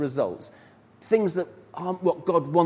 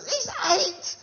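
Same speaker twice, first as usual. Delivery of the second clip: high incredulous laughing yelp; disbelief. Retold or imitated. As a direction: blurt it out in shock, half laughing. Disbelief at boy captain.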